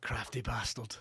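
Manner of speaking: low, very soft mutter; mock-resentful aside, dropped right down; muttered grudging realisation. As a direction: mutter it darkly under your breath. Mock realisation of dad's trick.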